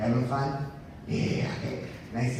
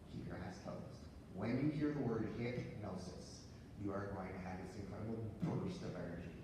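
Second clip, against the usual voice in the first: hypnotic induction; 2 semitones lower, 7 dB quieter, faster than usual. Acted. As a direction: lull them under, quiet and sure.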